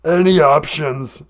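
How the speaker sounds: slow, broken-English puppet monster; Cookie Monster. Acted. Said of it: "whenever Cookie Monster calls in".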